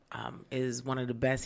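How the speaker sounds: normal talking voice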